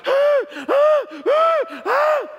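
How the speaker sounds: loud, very high wailing cries; imitated wounded-animal wail. Preacher imitating the flailing boy held down, wounded-animal wail. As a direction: mimic a hurt animal's repeated yelps. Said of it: "crying like a wounded animal".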